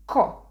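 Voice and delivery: ko as hard k plus open O, loud and short; Italian sound demo. Shown as a demonstration. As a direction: punch out ko as one isolated syllable. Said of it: "pronounce the K sound followed by the".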